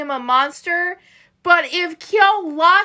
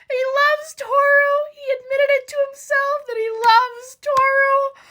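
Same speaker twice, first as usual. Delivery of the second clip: tearing up; 9 semitones higher than usual. Real, not acted. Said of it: "I'm tearing up".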